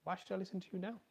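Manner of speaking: put-on sulky teenager throwing it back; resentful teenager. Teen child snapping back at parent, resentful whine. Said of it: "they'll just turn and say".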